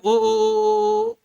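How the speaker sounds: ओ sung out long and high; drawn-out vowel. Shown as a demonstration. Teacher showing students the ओ sound, drawn out high. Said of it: "मध्य में ओ".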